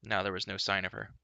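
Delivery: ordinary delivery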